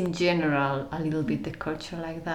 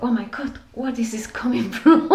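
higher-pitched amazed reaction, half laughing; retold amazement; 6 semitones higher, 6 dB louder than usual. Traveler retelling her reaction to being asked in, amazed. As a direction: relive your astonishment, pitch up, laugh on the end.

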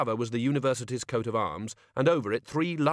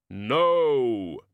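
booming shout; 5 dB louder than usual. A narrator's reading. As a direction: bellow it as Death. Death shouts no.

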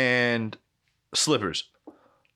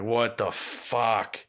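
slow stunned disbelief; much slower than usual. Retold or imitated. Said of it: "I'm like".

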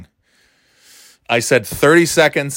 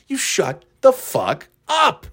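"shut the fuck up" slow and high, word by word; emphatic scolding; 8 semitones higher, slower than usual. Acted. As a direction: space out "shut", "the fuck", "up" as an exasperated order. Emphatic order to imagined audience.

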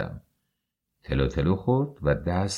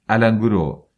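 angry insistence; 3 semitones higher, 6 dB louder than usual. Acted. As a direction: hammer it home. Husband insists in quarrel. (تأکید بلند و تند)

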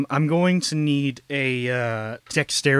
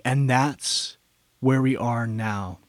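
usual speaking voice

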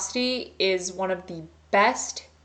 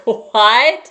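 shocked cry; 3 semitones higher, 14 dB louder than usual. Retold or imitated. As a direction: blurt "What?" as a stunned shout. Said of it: "I was just so shook".

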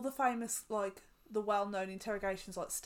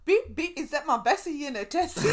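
loud, higher playful voice; sing-song teasing character voice; mock posh girl; 6 semitones higher, 11 dB louder than usual. Mocking the flashy driver.

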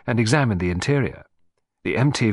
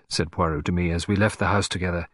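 usual speaking voice

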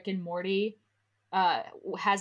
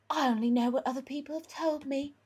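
higher put-on boy-wizard voice; Harry; 6 semitones higher than usual. A narrator's reading. Harry answering the old man's question, higher character voice. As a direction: play the young wizard, pitched up. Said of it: "Harry said".

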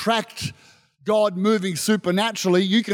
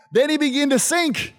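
ordinary talk

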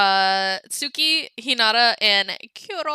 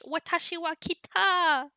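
higher, put-on anime-style Japanese; 6 semitones higher, 5 dB quieter than usual. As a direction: say it like a cute anime girl. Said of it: "I'd text her and be like".

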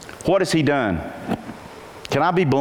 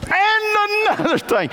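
much higher, slow, mock-stern father piling on; lecturing dad; slower than usual. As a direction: play a dad who won't stop lecturing. Dad's lecture recalled from childhood.

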